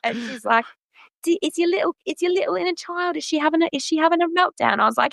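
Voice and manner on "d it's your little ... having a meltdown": much higher, playful British accent impression. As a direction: tease her like a kind British star. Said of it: "like, British accent".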